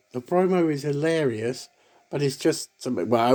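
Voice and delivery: normal talking voice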